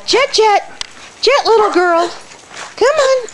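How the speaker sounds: sing-song high call to a shy puppy; high, sweet pet-talk call; high coaxing call. Real, not acted. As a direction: coax the dog over, way up high. Coaxing shy dog onto camera.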